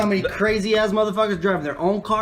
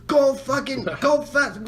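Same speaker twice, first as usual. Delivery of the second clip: higher-pitched mock yell; imitated yelling; 6 semitones higher, slower than usual. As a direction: imitate an irate driver yelling. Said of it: "he was yelling at me".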